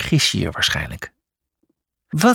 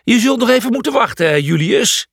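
high, hurried grumble; irritated, high-pitched brush-off; mild growl; 10 semitones higher, 4 dB louder than usual. A narrator's reading. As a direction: brush him off grumpily. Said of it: "gromde hij".

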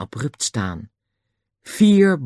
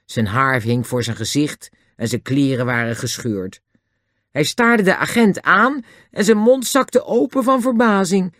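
usual speaking voice

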